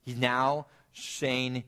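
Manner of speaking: ordinary talk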